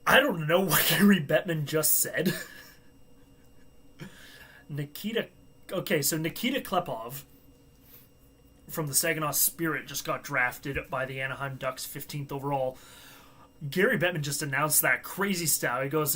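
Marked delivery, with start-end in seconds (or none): none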